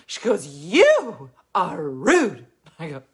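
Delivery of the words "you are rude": slow blunt accusation. Retold or imitated. Heckler's blunt retort.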